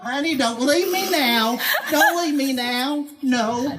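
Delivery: mock pleading honey; pleading quote. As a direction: beg him not to leave, half laughing. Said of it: "I'm going".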